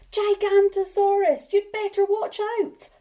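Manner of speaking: high, loud warning shout; alarm shout. Acted. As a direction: shout the warning across the hill. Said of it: "they heard a shout".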